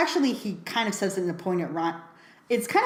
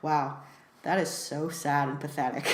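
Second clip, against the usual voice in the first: low, softer mocking pity; 4 semitones lower, 6 dB quieter than usual. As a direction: sneer in fake sympathy. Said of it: "makes fun of him for it".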